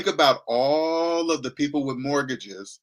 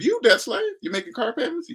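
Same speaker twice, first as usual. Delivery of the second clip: mock taunt. Mock confronting a debt slave.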